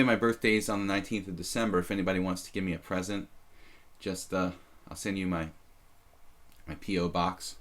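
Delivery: ordinary talk, nothing marked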